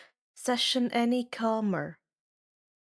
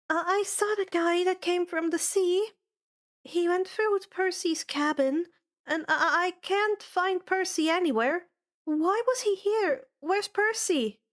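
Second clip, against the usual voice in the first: put-on high, stammering, frightened girl's voice; a scared girl pleading for news; frantic girl; 9 semitones higher than usual. Annabeth to Dionysus, high stammering panic.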